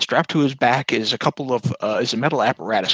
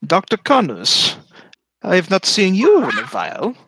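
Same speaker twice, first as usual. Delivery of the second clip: gnome scientist greeting; 8 semitones higher, 4 dB louder than usual. Acted. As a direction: greet an old rival with false politeness. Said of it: "and he says".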